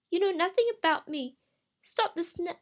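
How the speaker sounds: high, loud defiant protest; angry outburst. Acted. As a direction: lash out and get cut off. Crystal snaps back at voice.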